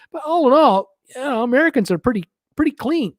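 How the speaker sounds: sing-song ironic compliment; sarcastic praise. Real, not acted. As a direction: lift it up high, tongue in cheek.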